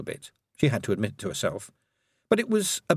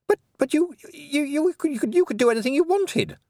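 high, stammering outburst; burst out. A narrator's reading. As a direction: blurt it in a rush, voice way up. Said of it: "Roland burst out".

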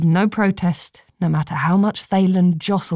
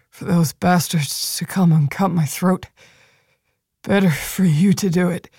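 wounded soldier, weak. Acted. Wounded Hake's voice.

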